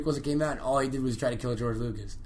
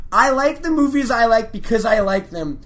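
higher and louder defiant rant; retold fan snapping back; angry rant; 7 semitones higher, 10 dB louder than usual. Comic acting out Patton ranting at Lucas, heated defiance. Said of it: "And he goes".

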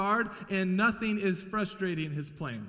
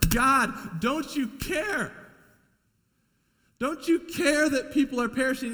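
anguished prayer; 5 semitones higher, 4 dB louder than usual. Retold or imitated. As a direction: cry out to God as if heartbroken. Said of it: "I literally have said these words like".

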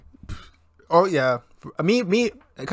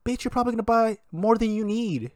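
sassy retort; 9 semitones higher than usual. Retold or imitated. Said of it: "I was like".

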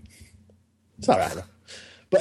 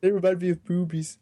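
silly high voice; 11 semitones higher than usual. Retold or imitated. S2 voicing snickering guy.

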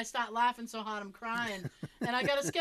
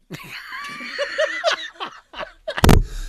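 a real, screaming laugh, much higher and louder; shrieking laughter; 14 dB louder than usual. Real, not acted. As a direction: howl with laughter. Laughs at granddad's pizza line.

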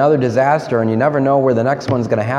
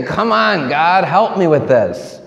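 high, exasperated plea, slowing on the last words; mock scream to God; 5 semitones higher, slower than usual. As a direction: cry out to God in frustration. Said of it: "you just scream and say".

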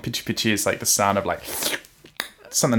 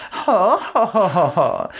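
high, haughty mock laugh falling away; rich-girl shoujo laugh; 8 semitones higher, slower than usual. Shown as a demonstration. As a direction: crow it like a scheming princess. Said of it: "which is supposed to be like".

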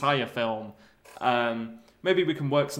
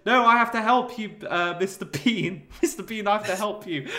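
higher, louder earnest plea; hammed-up pleading; 6 semitones higher, 4 dB louder than usual. Doris pleading to save Mister Bean, mock plea. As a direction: beg him to let you help.

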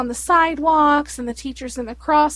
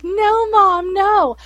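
put-on higher pleading kid voice, lightly done; a hint of a whiny child saying no; daughter protesting; 6 semitones higher, 4 dB louder, much slower than usual. Daughter protesting to her teasing mom, lightly voiced child. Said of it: "she's like".

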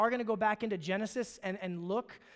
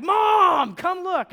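put-on much higher and louder, a kid's gleeful call; calling out to mom; 10 semitones higher, 13 dB louder, much slower than usual. Boy calling his mother down to the garage, a much higher, louder gleeful shout. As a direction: shout for mom like a vindicated eleven-year-old.

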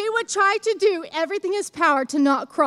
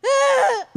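put-on baby's giving-up groan; 10 semitones higher, 4 dB louder than usual. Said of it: "then he couldn't do it".

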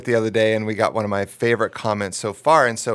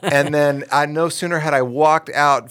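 normal talking voice

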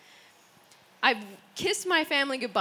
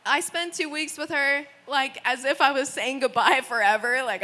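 ordinary delivery

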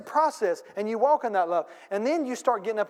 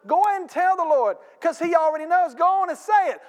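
preaching shout; 8 semitones higher, 5 dB louder than usual. Real, not acted. Preacher urging the church to pray, loud and rising. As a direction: urge them on, loud and high.

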